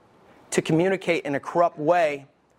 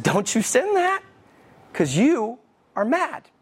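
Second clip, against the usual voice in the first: imitating a wise little daughter, higher pitch; little woman of wisdom; 5 semitones higher than usual. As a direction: scold Dad in a raised child's voice. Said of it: "Like a little woman of wisdom".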